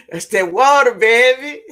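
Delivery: proud laughing brag. Real, not acted. Laughing boast about water.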